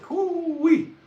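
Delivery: very high, loud "hoo-wee"; high whoop. Retold or imitated. Nearly canceled for that one, high whoop. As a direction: whoop it out in mock relief. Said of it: "I was like".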